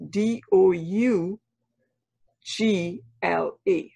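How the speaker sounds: slow letter-by-letter spelling, D O U G L A; each letter of "Dougla" said apart, slow and deliberate. Shown as a demonstration. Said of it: "pronounce for me again and for our listeners".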